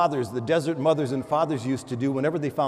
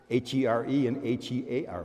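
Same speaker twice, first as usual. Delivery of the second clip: spelled out letters; 3 semitones lower, 3 dB quieter than usual. Shown as a demonstration. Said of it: "spelling out".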